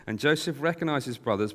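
ordinary delivery, nothing marked

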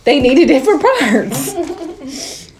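slow, drawn-out mocking echo of her words; mocking repeat. Friend teasing a friend by slowly echoing her excuse, mock drawl. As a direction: stretch it out to tease her. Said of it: "mocking repeat".

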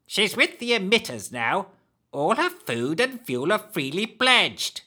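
high hag voice. Acted. Hag sends him away.

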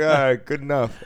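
imagined passerby shrugging it off; satisfied passerby. Pretend. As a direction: accept it like good enough. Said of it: "He was like".

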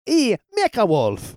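mock Spanish accent. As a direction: drag "E Mecha Wolf" out high in a mock Spanish-Mexican accent. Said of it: "But you didn't do a Spanish accent for m".

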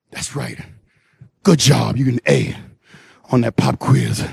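sudden drop to a low voice; low, measured grading; mock teacher approval. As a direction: praise the pupil in a deep, settled voice. Mock teacher praises interviewer.

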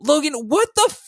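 loud, high, fed-up yell; exasperated yell. Acted. Maddie yelling at Logan about the chaos, exasperated loud yell. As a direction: demand an answer at full volume.

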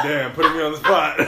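talking through laughter; laughing. Real, not acted. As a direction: laugh out the words while talking. Laughing while recalling being on the spot.

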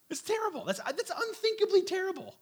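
aghast, high and pleading; anguished. Real, not acted. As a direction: let the horror break your voice upward. Preacher aghast at child sacrifice.

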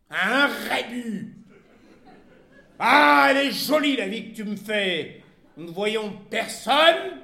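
exasperated complaint. A narrator's reading. Wife complaining to husband about their life, exasperated. Wife's quarrel with husband in story.

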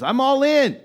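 very high, loud mocking pledge; hammed-up shouted vow; mock fervent shout. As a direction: belt it out like an overeager promise. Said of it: "What they should say is".

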